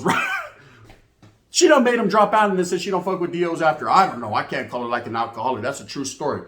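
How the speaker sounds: ordinary talk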